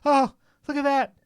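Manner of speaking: high-pitched mock-ecstatic exclamations; ecstatic scientists. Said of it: "the scientists seeing the data for the first time were ecstatic".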